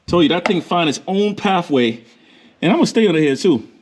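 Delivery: ordinary delivery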